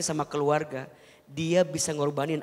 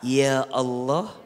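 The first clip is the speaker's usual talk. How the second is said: slow, chanted Arabic prayer; sung supplication, measured and reverent; prayer recitation; slower than usual. Acted. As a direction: intone the dua slowly and devoutly.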